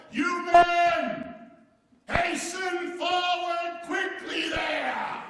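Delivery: slow drawn-out hollering command; full yell. Retold or imitated. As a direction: bellow across the prairie to the cowhands. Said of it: "I yelled to my men as loud, as loudly as I could yell".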